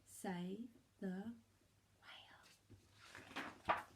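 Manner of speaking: soft breathless whisper; the snail's trail spelling out its message, whispered. A narrator's reading. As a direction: breathe the words like a secret. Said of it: "a silvery trail saying".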